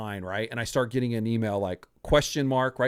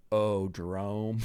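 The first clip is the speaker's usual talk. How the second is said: dismayed colleague; 6 semitones lower, 6 dB quieter, much slower than usual. Retold or imitated. Dan reacts on speakerphone.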